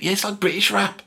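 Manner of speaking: imitating a street rapper. Rapper agreeing with his mate, high mimicked voice. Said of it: "they go".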